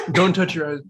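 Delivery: ordinary talk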